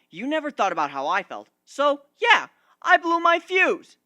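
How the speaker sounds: heated defence. Acted. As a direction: build up until you blow your fuse.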